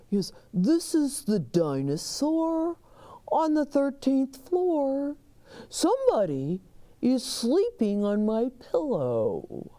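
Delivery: dinosaur voice on the phone; lightly voiced dinosaur caller. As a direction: complain as the dinosaur.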